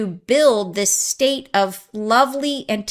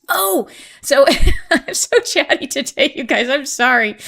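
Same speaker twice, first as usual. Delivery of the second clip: giggling, voice jumping higher; laughing; 5 semitones higher, 4 dB louder than usual. Real, not acted. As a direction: laugh through the words, bubbling over. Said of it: "I get excited".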